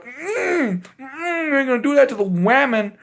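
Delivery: high-pitched mock scolding, humming first. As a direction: wag a finger at him, squeaky and high. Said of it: "was like".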